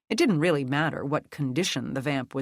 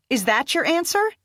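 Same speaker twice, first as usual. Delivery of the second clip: high, loud taunting challenge; fierce mocking challenge; taunting threat; 12 semitones higher, 5 dB louder than usual. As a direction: sneer the question. Becca taunting after snarl.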